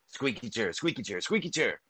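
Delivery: normal talking voice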